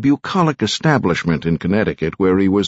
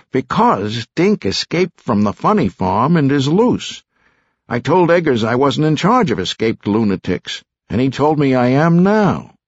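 put-on a touch higher, indignant young associate; lightly voiced aggrieved young man; 6 semitones higher than usual. A narrator's reading. Young associate protests to Stone, mildly whiny. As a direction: complain like an exasperated junior lawyer. Narrator voices Herbie complaining.

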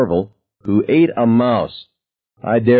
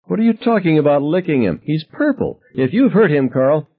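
protective anger; 8 semitones higher than usual. A narrator's reading. Mother confronting angry husband.

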